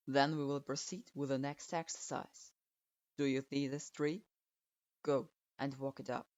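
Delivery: put-on low, curt old teacher grading a student; a grudging low verdict; stern sensei voice. A narrator's reading. Strict sensei grudgingly approving his student, low and curt.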